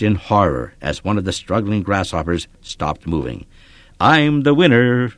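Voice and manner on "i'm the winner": gloating, slow and higher; chortling. A narrator's reading. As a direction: savor the win with a chuckle. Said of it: "the redhead chortled".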